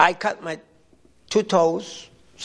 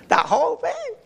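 much higher pitch, through a laugh; 10 semitones higher than usual. Real, not acted. Husband confessing to the pizza binge, laughing. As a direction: repeat it with a rising, amused squeal.

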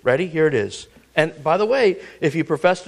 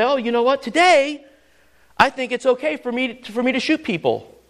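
higher, casual mock self-justifier; mock reasoning person; 7 semitones higher than usual. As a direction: play someone lightly making up his own rules. Imagined person deciding own rules.

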